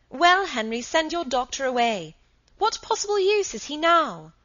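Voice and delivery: high, loud, indignant protest; heated demand. A narrator's reading. Margaret demands Henry send the doctor away, heatedly. Wife argues back at husband.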